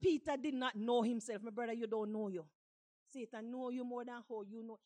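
usual speaking voice

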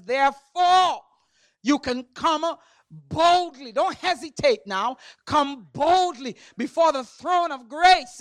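loud, rousing scripture preaching; full-force preaching. Real, not acted. Preacher urging congregation to come boldly to grace, full-force preaching. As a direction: urge them on like a revival preacher.

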